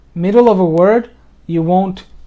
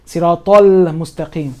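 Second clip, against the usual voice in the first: Siratal Mustaqim with the l leaned on; emphasized lam. Shown as a demonstration. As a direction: lean on the final l of Siratal. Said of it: "Now I'm emphasizing it so you can hear".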